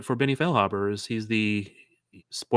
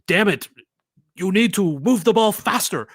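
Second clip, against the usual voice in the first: put-on Spanish accent; 7 semitones higher, 3 dB louder than usual. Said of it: "Was that your Spanish accent?".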